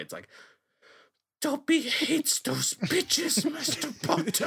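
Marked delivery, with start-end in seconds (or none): 1.4-4.5 very high, slow squeaky house-elf; Dobby voice. Acted. Friends doing their Dobby voice, high slow squeak. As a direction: screech it slowly like a buff Dobby. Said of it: "with Dobby, impossible to be quiet".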